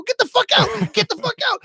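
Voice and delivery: very high, frantic retold yell; mad yelling. Dad to daughter hogging the bathroom, high frantic mad yell. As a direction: shriek it, desperate for the bathroom. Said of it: "I got so mad".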